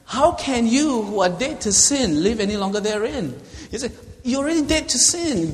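high, loud, preaching at full force; Paul rebuking sinners, high and loud; impassioned Paul. Retold or imitated. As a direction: thunder it from the pulpit. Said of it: "He's banging and saying".

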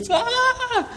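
sudden high laughing yelp of panic; panicked yelp. Retold or imitated. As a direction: yelp like a man trapped in a machine. Man stuck in washing machine.

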